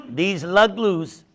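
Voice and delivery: usual speaking voice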